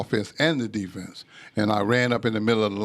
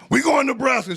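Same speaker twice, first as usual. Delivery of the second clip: much higher, louder proud announcement; booming verdict; 4 dB louder than usual. Retold or imitated. Father settling the school choice, loud proud verdict. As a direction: announce it like a triumph.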